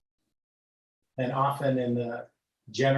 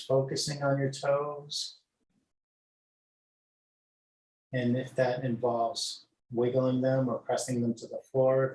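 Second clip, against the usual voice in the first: guided meditation. Acted. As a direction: lull them gently from toes upward. Leads body scan meditation.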